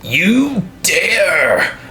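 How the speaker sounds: booming barbarian warrior threat; slow, menacing warrior roar. Acted. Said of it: "Hrolf ... says".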